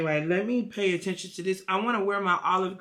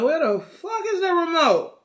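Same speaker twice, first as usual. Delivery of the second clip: higher, louder exasperated retelling; 10 semitones higher, 6 dB louder than usual. Retold or imitated. Man griping to himself about the missing remote, exasperated. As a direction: act out an irritated demand.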